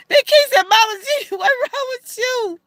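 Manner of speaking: high, squealing with laughter; laughing retelling. Retold or imitated. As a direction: quote her through giggles.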